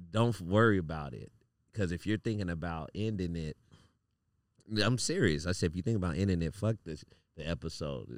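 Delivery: calm him down gently; reassuring. Retold or imitated. Consoling a distressed friend, retold.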